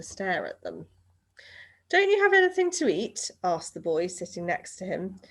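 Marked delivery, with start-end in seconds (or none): 1.9-3.3 put-on high, louder little boy voice; bright high child's question; boy's voice. A narrator's reading. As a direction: voice a curious young boy. Boy voiced in read story.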